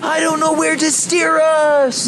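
high urgent yell; yelling over the storm. Acted. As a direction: shout it over the waves. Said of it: "yelled Thomas".

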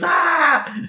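short high-pitched scream; mock scream. Host retelling Homer getting zapped, mock screaming. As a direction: yelp as if zapped. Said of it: "Homer screams".

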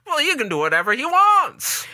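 put-on old-timey cop. Voicing the policeman.

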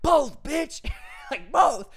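high, loud Both bitch; defiant yell. Retold or imitated. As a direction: shout it back in his face. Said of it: "And the homeless guy's like".

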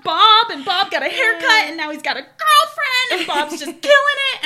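high, loud, laughing cheer; giddy excitement. Retold or imitated. Cheering for Bob's makeover while watching the show, laughing excitedly. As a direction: squeal with delight as if you helped Bob yourself. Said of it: "I'm just like".

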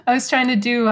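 usual speaking voice

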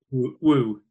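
loud wrestler-style whoop. Pretend. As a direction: whoop it like Ric Flair's catchphrase. Theme song title whoop.

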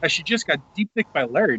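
ordinary talk, nothing marked

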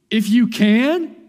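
loud, high, rising at the end; incredulous echo. Retold or imitated. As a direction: throw the words back as a challenge. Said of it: "He responds to him by saying".

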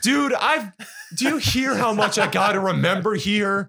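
pleading, overwhelmed, half-laughing; exasperated protest. Real, not acted. Defends himself, exasperated, to friends.

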